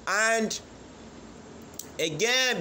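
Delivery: ordinary delivery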